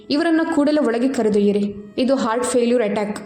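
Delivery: plain reading voice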